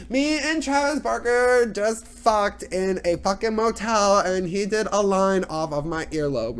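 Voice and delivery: high, slow, loud reality-star caricature; hammed-up high celebrity drawl; Kourtney Kardashian. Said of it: "she's just like".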